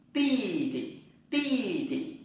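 ti tị stretched very slowly and high; Chinese tone drill. Shown as a demonstration. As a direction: drag ti tị out slowly for learners. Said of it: "chúng ta tập lại".

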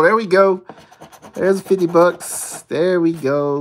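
loud, high happy cheer; excited. Real, not acted. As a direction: cheer the win loudly. Celebrating a win.

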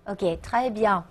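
normal talking voice

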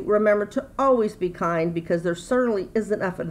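ordinary talk

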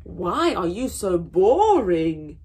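loud bored anteater. Acted. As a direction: complain it bluntly. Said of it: "the anteater asked".

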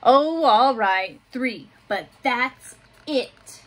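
put-on gentle picture-book reading for little kids; reading to children. A narrator's reading. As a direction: read it like story time in class. Teacher reads picture book aloud.

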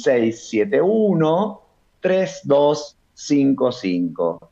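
slow, drawn-out phone number; dictado despacito. Real, not acted. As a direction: read out each digit slowly for note-taking. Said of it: "lo voy a decir despacito". (despacito, número por número)

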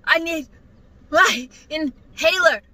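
halting, one word per breath; can't breathe. Retold or imitated. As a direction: imitate pleading through an asthma attack. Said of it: "and I can't breathe".

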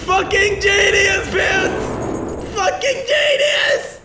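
excited laughing shouts. Real, not acted. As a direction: cackle and shout with delight. Hysterical after game moment.

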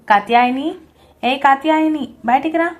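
loud angry calling out for his wife; shouting. A narrator's reading. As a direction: bellow her name from the next room. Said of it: "అని అరుస్తూ ఉన్నాడు".

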